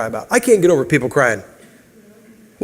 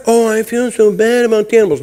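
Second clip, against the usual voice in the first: lightly mocking, sugary pity for animals; 5 semitones higher than usual.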